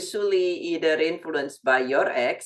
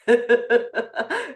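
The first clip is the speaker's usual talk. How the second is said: laughing burst; 6 semitones higher, 3 dB louder than usual. Real, not acted. Laughs at own remark.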